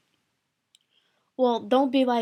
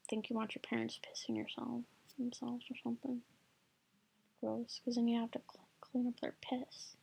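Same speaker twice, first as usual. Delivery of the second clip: whispering; 10 dB quieter than usual. Real, not acted.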